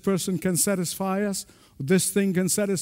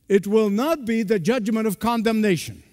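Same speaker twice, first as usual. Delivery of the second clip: normal talking voice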